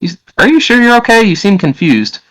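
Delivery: very high woman's voice; concerned woman. Acted. Woman to her confused visitor, high concerned voice. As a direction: check on him as a worried woman, pitched high. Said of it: "a voice from a woman say".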